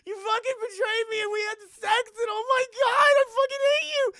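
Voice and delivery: very high, very loud shrieking tirade; betrayed screaming. Retold or imitated. Mia screaming at Brian after his betrayal, shrill furious tirade. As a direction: scream it at the man who lied to you.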